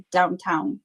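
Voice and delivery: usual speaking voice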